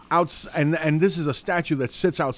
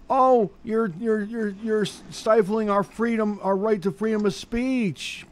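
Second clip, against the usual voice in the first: high-pitched whiny mock protester, pitched way up; 9 semitones higher, slower than usual. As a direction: sneer it out as a shrill, whiny protester. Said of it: "people would start screaming".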